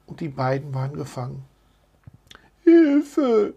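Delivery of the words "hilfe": tiny, high-pitched cry for help; squeaking mouse. A narrator's reading. Trapped mouse crying for help, squeaking. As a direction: squeak it like a frightened little mouse. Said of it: "piepste Mäuseline".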